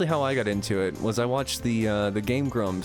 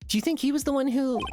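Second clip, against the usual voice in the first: put-on high girl voice; faster than usual. Character voice in game dialogue.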